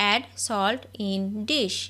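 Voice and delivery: very slow, word by word; each word drawn out for learners to copy; slow dictation. Shown as a demonstration. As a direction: spell it out slowly for the class. Repeated slowly for learners.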